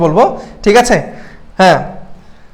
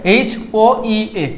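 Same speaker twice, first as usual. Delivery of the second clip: very slow, letter by letter; slow spelled letters; much slower than usual. Shown as a demonstration. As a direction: spell it out slowly for students. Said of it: "ভেঙে ভেঙে পড়বা". (খুব ধীরে বানান করে)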